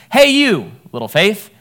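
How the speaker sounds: loud vocative call. Shown as a demonstration. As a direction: hail someone and then name them. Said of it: "And so it's".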